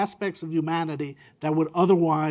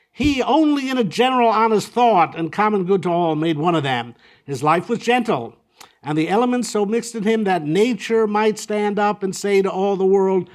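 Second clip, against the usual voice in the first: louder, higher, solemn recitation; declaiming Shakespeare; 4 semitones higher, 6 dB louder than usual. Acted. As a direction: deliver Antony's tribute like a stage actor. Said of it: "Antony's, uh, concluding tribute".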